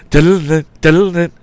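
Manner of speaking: high, loud sing-song cartoon riff; cartoon music sound. Reader imitating cartoon chase music, high sing-song riff. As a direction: mimic an old cartoon chase tune. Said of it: "music sound effects from the old cartoons".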